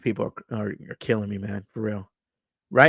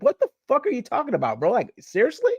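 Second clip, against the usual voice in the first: much higher, mock-outraged rant at an elder; incredulous outburst; 8 semitones higher than usual. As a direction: scold the old head in disbelief, pitched way up. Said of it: "I'm like".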